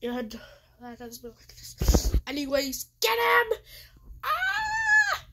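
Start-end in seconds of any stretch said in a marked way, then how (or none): 4.2-5.2 sharp high scream, full voice; sudden scream. Real, not acted. Gamer screams as the enemy charges, high scream. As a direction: let out a high startled scream. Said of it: "Why are you screaming".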